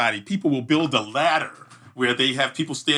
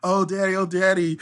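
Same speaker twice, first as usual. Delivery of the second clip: put-on kids crying; 7 semitones higher than usual. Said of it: "they're crying".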